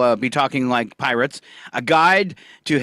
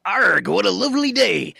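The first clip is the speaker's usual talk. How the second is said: light pirate voice; 6 semitones higher than usual. Retold or imitated.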